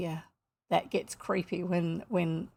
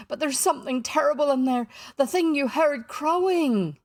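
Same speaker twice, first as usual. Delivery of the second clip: put-on high, loud, terrified accented voice; almost gibbering; 6 semitones higher, 6 dB louder than usual. A narrator's reading. Pirate tells of the terrible thing inside, almost gibbering. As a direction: wail it out, stretching the ending. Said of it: "almost gibbering".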